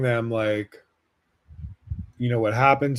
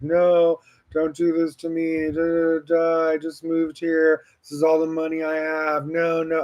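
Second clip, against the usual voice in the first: higher, lightly voiced tearful plea; a mild imitation of pleading through tears; retold tearful pleading; 4 semitones higher than usual. Pleading with the mugger, mildly tearful and high. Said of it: "And I just kept crying".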